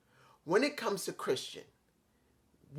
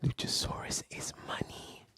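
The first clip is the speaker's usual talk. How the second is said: whisper; 6 dB quieter than usual. Acted. Aside after long pause.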